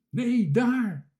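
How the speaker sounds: panicked shout, high and loud; Toontje. A narrator's reading. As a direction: scream a frightened warning. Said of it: "schreeuwde Toontje".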